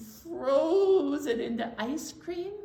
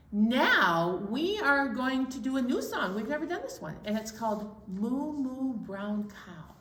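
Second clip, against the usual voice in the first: normal talking voice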